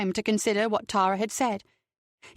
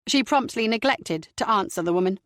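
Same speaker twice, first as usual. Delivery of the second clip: usual speaking voice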